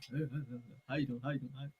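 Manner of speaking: imitating Charles brushing them off; standoffish Charles. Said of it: "That was him".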